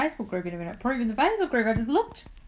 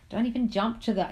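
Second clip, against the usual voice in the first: ordinary talk, nothing marked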